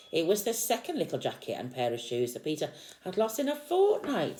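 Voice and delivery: put-on lively read-aloud to a small child; storybook reading. A narrator's reading. Parent reading Peter Rabbit to a child, lively storytelling. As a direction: read the picture book out with energy.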